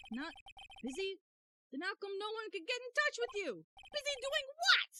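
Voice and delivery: the hero shrieking in disbelief; squeaky outraged lawyer. Acted. Player voicing the defense lawyer challenging Grossberg, shrill. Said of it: "your voices have been on point tonight".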